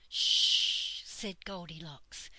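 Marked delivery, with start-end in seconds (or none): 0.1-1.0 loud, long shush; hushing shh. A narrator's reading. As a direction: hush them urgently. Hushing others near a sleeper.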